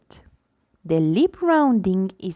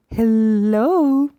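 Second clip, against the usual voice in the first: hello stretched out long, a little louder; 2 semitones higher, 4 dB louder than usual. Shown as a demonstration. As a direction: draw the word out to a baby. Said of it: "she stretched the word out".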